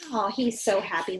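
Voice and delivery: ordinary delivery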